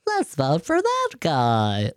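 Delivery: slow, dopey voter voice; mocking a clueless voter at the polls, dim and slow. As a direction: mimic a gullible voter slowly. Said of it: "some people are around the voting office going".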